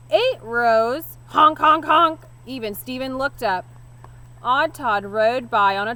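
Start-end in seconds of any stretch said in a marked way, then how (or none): 0.1-2.2 loud high honks like a parrot horn; honking horn. A narrator's reading. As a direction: blast out the honks gleefully.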